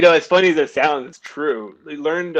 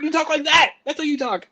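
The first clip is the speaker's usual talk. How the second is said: high, loud mock exasperation; emphatic retort; 14 semitones higher, 4 dB louder than usual. Replaying his argument with his family, high emphatic retort. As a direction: fire it back, pitched way up. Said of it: "And I'm like".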